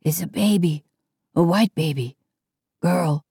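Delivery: rasping, half-voiced whisper; hoarse whisper. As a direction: whisper it hoarsely, breathing the words. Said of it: "whispered hoarsely".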